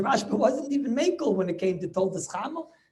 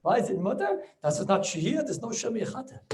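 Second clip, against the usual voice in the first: ordinary talk, nothing marked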